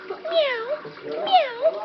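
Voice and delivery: high cat meow, falling then rising; imitated meow. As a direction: meow like a kitty.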